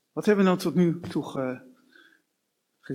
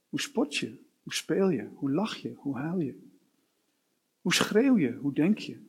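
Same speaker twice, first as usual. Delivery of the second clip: ordinary talk, nothing marked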